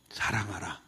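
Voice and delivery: low, near-whispered key word; hushed command. Acted. Preacher giving Jesus's command to love, hushed. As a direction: drop to a soft, breath-like low murmur.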